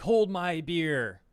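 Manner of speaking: loud, high, drawn-out boast; cocky boast. Acted. Streamer voices Australia trying to outdo the UK, cocky boast. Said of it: "Australia sees what's happening ... and they're like".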